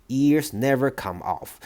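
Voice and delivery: ordinary delivery, nothing marked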